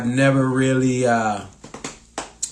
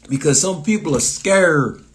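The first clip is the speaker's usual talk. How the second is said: ordinary talk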